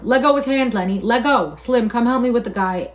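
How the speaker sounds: loud, high, urgent commands; shouting. A narrator's reading. George trying to free Curly's hand, shouting. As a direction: shout for release and for help. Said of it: "George shouted over and over".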